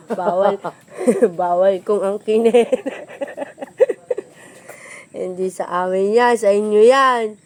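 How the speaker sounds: 1 laughing protest. Real, not acted. Laughing protest about ownership.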